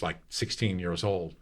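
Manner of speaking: ordinary delivery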